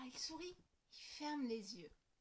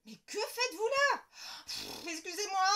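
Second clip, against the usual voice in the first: startled indignation; 8 semitones higher, 12 dB louder than usual. Acted. Startled stranger, apology.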